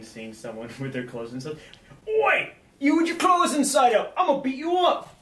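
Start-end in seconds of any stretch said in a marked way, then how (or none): 2.1-5.1 much higher, louder, hammed-up Cockney yell; a cartoonish Cockney thug shouting "Oi!"; bad Cockney accent. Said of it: "like, a Cockney accent".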